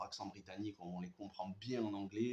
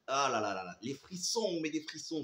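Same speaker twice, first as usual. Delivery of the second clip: excited, higher and louder outburst; thrilled, raised-pitch exclamation; awestruck gushing; 5 semitones higher, 5 dB louder than usual. Real, not acted. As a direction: gush with chills of delight. Gushing over the returning composer.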